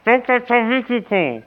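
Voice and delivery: mumbling round a mouthful of gauze; mouth full. Pretend. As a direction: slur the name through dental packing. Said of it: "Ich hab eine Tamponade im Mund".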